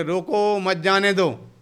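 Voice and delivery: "रोको" drawn out, then "मत जाने दो" as one phrase; slow call, splitting "रोको" from "मत जाने दो"; pause after रोको. Shown as a demonstration. Preacher showing how meaning shifts, pause after "रोको". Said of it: "शब्द तो एक ही है".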